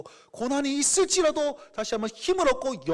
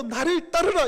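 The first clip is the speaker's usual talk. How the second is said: louder, higher, slow; strong call; 7 semitones higher, 5 dB louder than usual. Acted. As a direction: urge them on with force. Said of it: "부활의 감격으로 회복되고". (힘차게 외치는 부르심)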